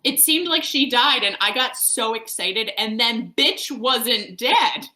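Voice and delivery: loud, high, exasperated rant; worked-up venting. Real, not acted. Reader venting to co-hosts about the witch surviving, exasperated. Venting outrage at plot twist.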